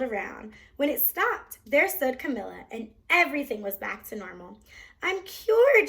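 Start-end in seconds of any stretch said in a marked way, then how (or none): 5.0-5.9 high, loud joyful shout; girl shouting for joy at being cured. A narrator's reading. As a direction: cry out in delight. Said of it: "she shouted".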